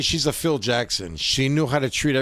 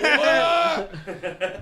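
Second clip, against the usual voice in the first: high laughing "What?" in disbelief; surprised daughter; 11 semitones higher than usual. Retold or imitated. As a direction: squeal it like a stunned teen, half laughing. Daughter reacts in retold story.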